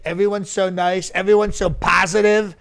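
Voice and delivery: normal talking voice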